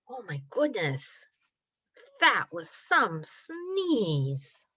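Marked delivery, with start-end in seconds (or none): none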